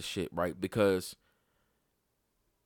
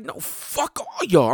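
defiant; 7 semitones higher, 7 dB louder, slower than usual. Retold or imitated. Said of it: "I'm on some shit like".

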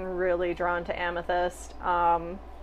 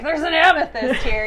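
loud, higher re-enacted outburst; excited discovery; 8 semitones higher, 9 dB louder than usual. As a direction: act out suddenly spotting treasure. Acts out sudden discovery in shop.

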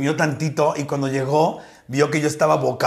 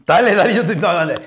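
ordinary talk, nothing marked

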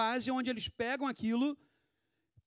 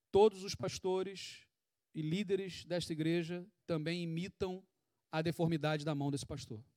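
normal talking voice

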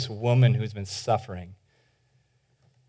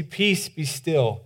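calm command; 6 dB quieter, much slower than usual. Acted. Jesus calming the sea.

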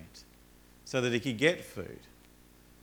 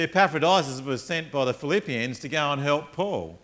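ordinary talk

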